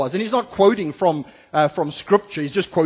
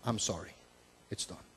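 soft, much quieter contrite admission; quiet repentance; 10 semitones lower, 20 dB quieter than usual. Acted. Preacher voicing Paul's quiet contrite confession. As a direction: let it fall away to almost nothing. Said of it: "and saying".

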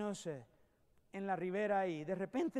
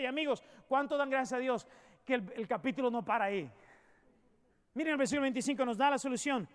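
loud, rising triumphant sermon voice; fervent pulpit crescendo; preaching at full force; 3 semitones higher, 4 dB louder than usual. Acted. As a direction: proclaim the victory in Christ.

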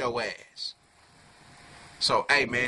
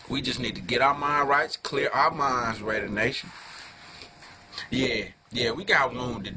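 ordinary talk

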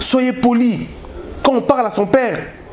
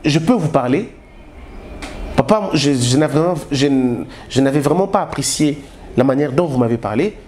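much lower, measured and deferential; respectful child to father; 9 semitones lower than usual. Shown as a demonstration. Grown son calmly telling his father he felt hurt, low and deferential. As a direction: speak up to your father humbly but firmly. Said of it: "vous attendez et vous lui dites après".

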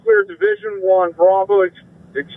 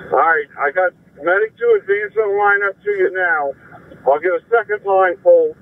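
ordinary talk

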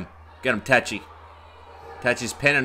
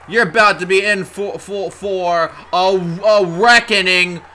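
loud, raised, stretched-out warning; excited hype; 5 semitones higher, 6 dB louder than usual. Real, not acted. Commentator warning wrestler of a reckoning, excited hype. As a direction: build up the coming punishment. Hypes wrestler's coming attack.

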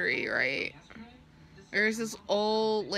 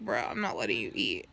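imitating friend; 11 semitones lower than usual. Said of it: "she was like".

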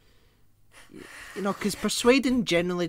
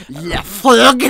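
loud hammed-up French Le Frog; mock French accent, loud; overly French accent; 4 semitones higher, 17 dB louder than usual. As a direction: belt Le Frog like a cartoon Frenchman. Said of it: "overly French accent I can".

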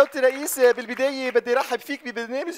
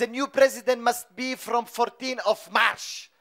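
put-on Arabic-accented English; much slower than usual.

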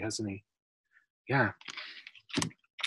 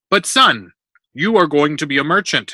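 higher, louder, emphatic protest; fatherly objection; 4 semitones higher, 9 dB louder than usual. A narrator's reading. Father correcting his son's career dream, insistent. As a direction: insist on the family trade.

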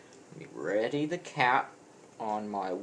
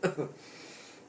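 breathy laugh; 4 semitones higher, 3 dB quieter than usual. Real, not acted. Laughing after cinnamon challenge.

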